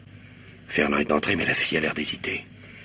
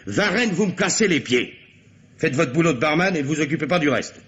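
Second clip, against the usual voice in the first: exasperated boss giving an ultimatum; exasperated order; 12 semitones higher than usual. Acted. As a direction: lose patience with your barman and lay down the law. Commissaire loses patience with Varenne.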